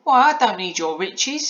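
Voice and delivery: high, loud, slow astonishment; taken-aback little girl, words stretched; Polly astonished. A narrator's reading. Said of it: "said Polly in astonishment".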